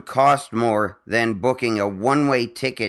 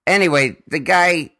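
normal talking voice

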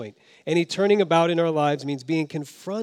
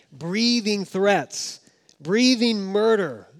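menacing; 5 semitones higher, 3 dB louder, slower than usual. Acted. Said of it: "that's quite a picture".

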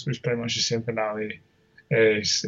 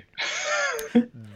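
a giggle far higher than his voice; high burst of laughter; 5 dB quieter than usual. Real, not acted. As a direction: crack up at his own joke. Laughs at striptease joke.

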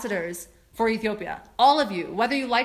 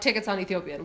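ordinary talk

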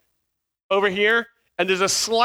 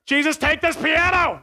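desperate plea; 9 semitones higher than usual. Retold or imitated. The donkey sizing him up, desperate prayer. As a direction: beg heaven for rescue. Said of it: "ever Jesus Take the Wheel?".

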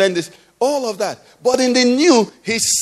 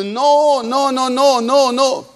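high, sustained run of NO, NO, NO; repeated NO held up high; insistent denial; 6 semitones higher, slower than usual. Real, not acted. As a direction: fire off each NO as a raised, emphatic refusal. Preacher insistently denies the idea.

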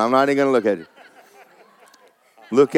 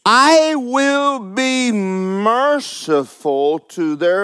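very slow, drawn-out verse reading; measured pulpit declamation; solemn scripture proclamation; 4 semitones higher, much slower than usual. Real, not acted. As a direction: stretch each word out, starting high and settling down.

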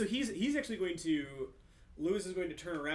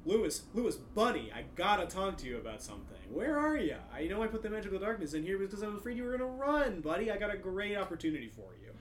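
fast, higher and louder wheedling menace; a villain calling sweetly into the dark; frightening voice; 6 semitones higher, 4 dB louder, faster than usual. Acted. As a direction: coax him like a creepy salesman. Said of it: "in his best sort of, like, frightening voice".

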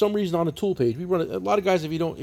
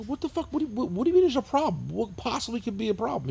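baffled; 6 semitones higher than usual. Retold or imitated. Acting his baffled reply to son.

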